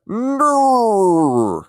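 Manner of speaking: a hammed-up Aussie "No", like a dying bear; Australian "No" with a groaning high pitch; terrible Australian impression. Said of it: "such a terrible impression".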